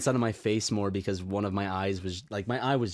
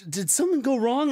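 alarmed; 12 semitones higher than usual. Retold or imitated. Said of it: "I'm like".

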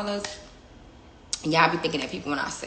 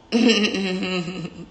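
laugh; 5 dB louder than usual. Real, not acted. After her power outburst.

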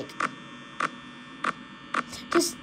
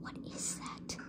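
whisper; 21 dB quieter than usual. Real, not acted. Hears noise in the dark.

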